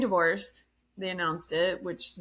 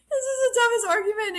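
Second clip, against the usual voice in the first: very high, loud squeal of amusement; helpless amused disbelief; 5 dB louder than usual. Real, not acted. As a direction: squeal it, overcome with laughter. Repeats it, overcome by laughter.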